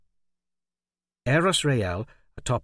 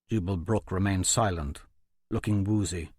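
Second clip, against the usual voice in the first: plain reading voice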